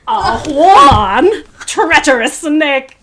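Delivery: slow, loud hammed-up snarl of accusation; hammed-up reenactment of their favorite scene; mock villain accusation. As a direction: drag out the word woman in mock outrage. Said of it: "You know when he's like".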